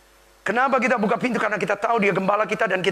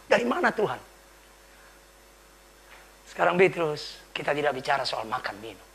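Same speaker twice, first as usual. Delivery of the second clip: usual speaking voice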